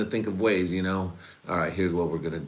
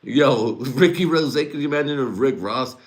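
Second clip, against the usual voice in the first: higher, louder put-on rapper voice; 6 semitones higher, 6 dB louder than usual. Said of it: "I turned him into [name]".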